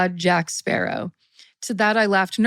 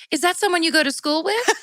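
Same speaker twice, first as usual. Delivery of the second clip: lightly voiced mom, higher and quick; higher, quicker, innocent question; mom's clueless question; 8 semitones higher, faster than usual. Mom texting back her daughter, lightly voiced clueless question. Voicing mom's text reply.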